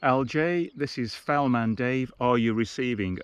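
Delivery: ordinary delivery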